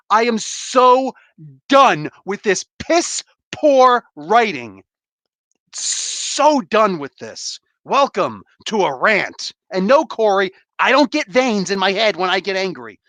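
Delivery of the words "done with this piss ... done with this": slow, heavy, every word stressed; drawn-out exasperation. Real, not acted. Fed-up reviewer slowly spells out how done he is. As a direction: drag each word out in disgust.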